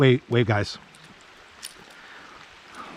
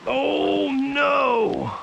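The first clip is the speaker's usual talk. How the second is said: long, high-held oh no; dismayed wail; 13 semitones higher than usual. Real, not acted. Angler losing a fish off the line, dismayed wail. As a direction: let the cry stretch out high. Fish gets away mid-fight.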